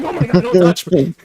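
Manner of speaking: high, loud, hammed-up recoil; exaggerated squeal of mock alarm; mock horrified outcry. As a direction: play a panicked yelp for laughs. Acting out a girlfriend recoiling.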